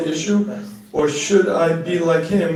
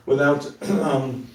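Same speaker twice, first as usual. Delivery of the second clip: normal talking voice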